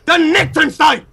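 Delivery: high, forceful faux-German bark; mock German commentator. A Jerry commentating StarCraft in fake German, high mock-German voice. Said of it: "I haven't spoken German".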